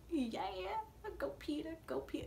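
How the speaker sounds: high, soft little cheer for Peter; root for him under your breath; hushed fan cheering; quiet cheer. Real, not acted.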